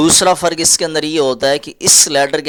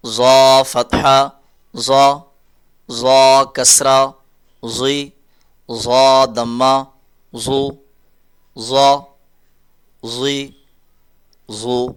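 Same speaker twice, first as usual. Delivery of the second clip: slow, drawn-out letter drill; slow teaching drill; much slower than usual. Shown as a demonstration. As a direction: spell each sound out slowly for children. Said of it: "teacher drilling letter with vowel marks". (آہستہ تدریسی مشق)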